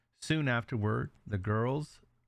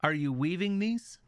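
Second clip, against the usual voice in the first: high, sing-song call to the niece; aunt calling out; 8 semitones higher than usual. Acted. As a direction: call up to her, sweetly. Aunt calls to the girl.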